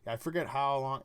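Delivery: ordinary talk, nothing marked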